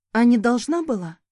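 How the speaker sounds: sarcastic comeback to her ex; snide. A narrator's reading. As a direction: needle him with a sly jab. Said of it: "Съехидничала".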